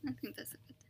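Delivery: whispers "I think that's a good" with no voice; whispering. Real, not acted. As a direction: breathes the words out softly, unvoiced. Repeats the line under her breath.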